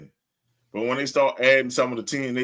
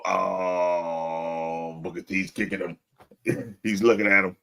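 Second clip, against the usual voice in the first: imitating commentator; 6 semitones lower, slower than usual. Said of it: "when he go".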